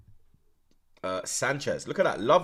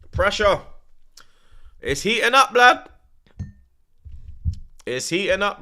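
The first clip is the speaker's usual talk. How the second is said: real, much higher, louder agitated calls; worked-up shouting; 6 semitones higher, 8 dB louder, slower than usual. Real, not acted. Fan still shouting at the match after Chelsea's goal, worked up. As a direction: keep yelling as the pressure builds.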